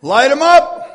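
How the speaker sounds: high loud shout of Light 'em up!; rallying shout. Real, not acted. Preacher shouting to his congregation to raise their lights, full cry. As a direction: blast it out to the whole room.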